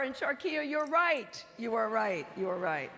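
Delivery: loud, high shout-out; calling out. Real, not acted. Speaking to the student in the audience, loud shout-out. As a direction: call out to her across the room.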